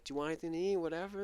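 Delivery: high-pitched, solicitous question; imitated food offer. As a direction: lightly mimic someone offering food. Voicing staff offering grandpa food.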